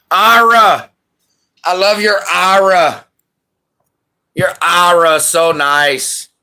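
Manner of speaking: high, loud Minnesotan lilt gushing over Ira; Minnesotan accent. Acted. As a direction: gush about the name Ira in a Minnesotan accent. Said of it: "I had a Minnesotan accent on that".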